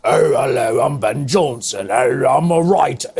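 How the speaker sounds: low, plummy mock English accent for the writers; mocking film accents. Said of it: "what the deuce was with those accents".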